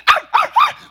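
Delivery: quick, very high-pitched little yelps; three short squeaky barks, imitated; high mimicked yelps. Pastor imitating wife and her dogs, high yelps. Mimicking in the dog-talk bit.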